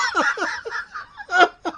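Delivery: squealing real laugh, very high. Real, not acted. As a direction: laugh out helplessly. Host laughs at own story.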